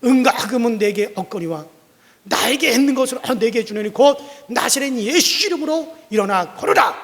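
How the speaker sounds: booming healing command; loud command. Acted. Pastor commanding the lame man to rise, loud. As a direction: proclaim it in a big voice. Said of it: "큰 소리로".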